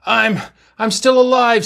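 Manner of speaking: high, strained and halting; loud, effortful gasp of a wounded man; last-breath effort. A narrator's reading. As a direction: push it out with what breath is left. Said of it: "with what breath he had left".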